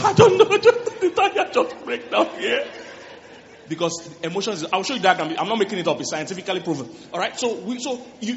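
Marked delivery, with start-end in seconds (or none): 0.0-2.7 high, loud mock wail; hammed-up tearful whine, far above usual voice; mock crying. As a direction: wail it as if helplessly crying over nothing. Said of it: "changing tire and be crying".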